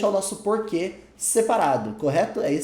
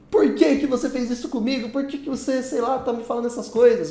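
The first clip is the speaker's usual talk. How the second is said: higher, louder accusing question; upset questioning voice; 6 semitones higher, 4 dB louder than usual. Retold or imitated. Acted friend demanding an answer, mildly upset. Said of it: "Você fala assim".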